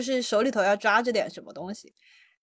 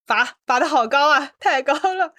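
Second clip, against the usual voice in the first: raised, loud mock praise; amused exclamation; 4 semitones higher, 8 dB louder than usual. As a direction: playfully exclaim it's too lofty. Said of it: "我说".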